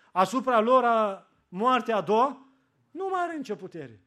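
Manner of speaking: loud, high, slowed fiery preaching; preaching at full force. Real, not acted. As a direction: proclaim it to the whole church. Preacher proclaims scripture. (predică în forță, tare și înalt)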